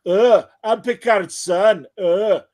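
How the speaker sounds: mock Wesley voice. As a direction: ham up a proud teenage boast. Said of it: "mock Wesley voice".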